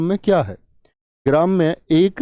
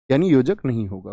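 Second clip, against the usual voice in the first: ordinary talk, nothing marked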